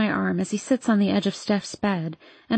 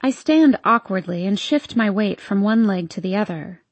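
usual speaking voice

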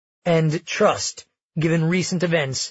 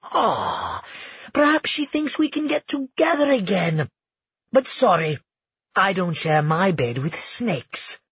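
high, cold sneering voice; cold mocking taunt; 3 semitones higher than usual. Felix taunts with cold grin.

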